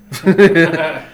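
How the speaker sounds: loud, high burst of laughter; short loud cackle. Real, not acted. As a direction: burst out laughing at your friend. Friend admits he is fucking hungry.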